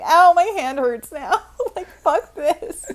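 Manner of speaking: laughing mock complaint. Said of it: "I'm like".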